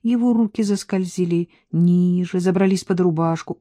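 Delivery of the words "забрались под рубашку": ordinary delivery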